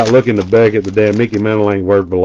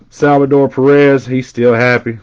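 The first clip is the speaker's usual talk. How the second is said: usual speaking voice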